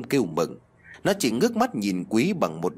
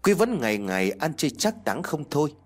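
ordinary delivery

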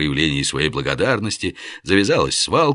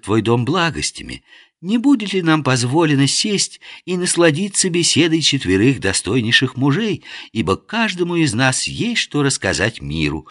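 meaningful; 7 semitones higher than usual. Acted. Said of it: "многозначительно выдал Насреддин".

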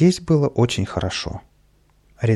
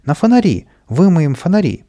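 much higher, louder whooping; gleeful yell; 5 semitones higher, 6 dB louder than usual. A narrator's reading. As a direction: shriek with mischievous delight. Kapriska whooping at lamps.

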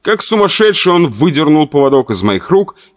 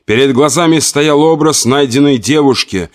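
ordinary delivery

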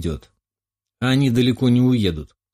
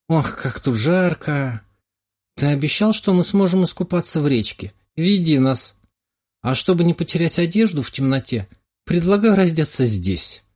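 tipsy; 6 semitones higher than usual. Acted. Drunk girl invites swimming.

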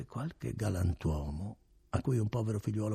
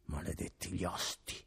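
softer muttered curse; exclaimed to himself; 6 dB quieter than usual. A narrator's reading. Renzo cursing innkeepers to himself, softer. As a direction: curse the innkeepers under the breath. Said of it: "esclamò Renzo fra sé".

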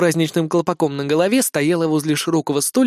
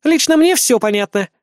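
high, louder sulky retort; pouting; 9 semitones higher, 5 dB louder than usual. A narrator's reading. Azumi defending herself to the captain, pouting. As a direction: answer back with a pout. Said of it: "надулась Азуми".

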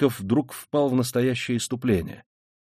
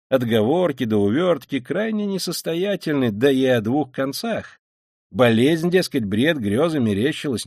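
mocking Porfiry; 3 semitones higher than usual. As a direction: play the sly interrogator toying with his suspect. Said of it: "весёлый и насмешливый вид".

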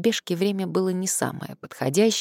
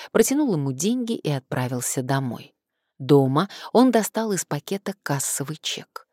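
ordinary delivery

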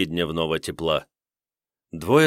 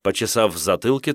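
ordinary delivery

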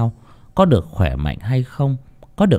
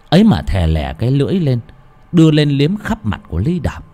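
ordinary delivery

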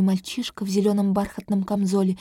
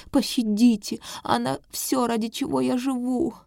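pleading mother; 3 semitones higher than usual. Acted. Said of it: "рыдает она".

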